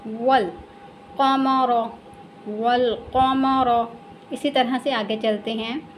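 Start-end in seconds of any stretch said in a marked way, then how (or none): 0.1-3.9 वल कमर slowly, short wal and heavy q; recited Quranic reading. Shown as a demonstration. Teacher repeats for learners to follow, slow recitation. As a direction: recite वल कमर syllable by syllable, wa joined to lam. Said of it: "लाम के साथ मिलाएंगे direct".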